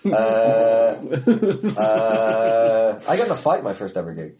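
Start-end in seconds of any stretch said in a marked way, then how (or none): 0.1-1.8 long, level, louder stalled "uh"; thrown-off "uh". Acted. Comic thrown by a heckler mid-set, blank drawn-out "uh". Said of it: "it just throws you off completely". 1.8-3.0 long, level, louder stalled "uh"; thrown-off "uh". Acted. Comic thrown by a heckler mid-set, blank drawn-out "uh". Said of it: "it just throws you off completely".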